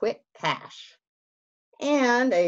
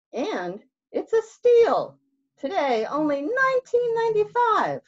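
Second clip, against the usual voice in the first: TV ad pitchman; 5 dB louder than usual. Acted. Fox pitching the price to Bear, high ad voice. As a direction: close the deal like a TV ad.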